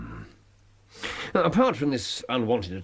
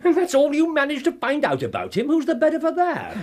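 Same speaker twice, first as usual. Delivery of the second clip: sharp, high, loud rebuke; scolding a disappointing partner; exasperated; 5 dB louder than usual. Acted. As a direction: snap at him in irritation. Holmes scolds Watson sharply.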